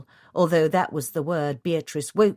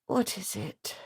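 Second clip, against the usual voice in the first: tired; 3 semitones higher, 7 dB quieter than usual. A narrator's reading. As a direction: sigh it out wearily. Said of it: "in a tired voice".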